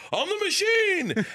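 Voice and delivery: very high, slow triumphant squeal; proud squeal. Retold or imitated. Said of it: "I'm like".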